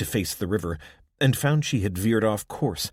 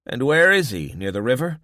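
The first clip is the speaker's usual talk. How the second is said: higher, louder brisk question; gruff old woman; 5 semitones higher, 7 dB louder than usual. A narrator's reading. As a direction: practical questioning. Irma asks.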